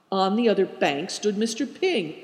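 plain reading voice

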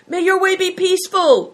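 very high, loud farewell shout from afar. A narrator's reading. A rider shouting goodbye to the boys from beyond the wall, loud. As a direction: shout the blessing across the courtyard. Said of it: "shouted Bator".

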